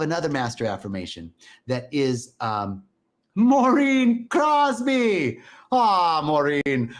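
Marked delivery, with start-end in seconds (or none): 3.4-6.9 put-on loud, drawn-out hammy Irish accent, pitched high; exaggerated, singsong Irish accent; bad Irish accent. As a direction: call out the name in a mock Irish lilt. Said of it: "my Irish accent".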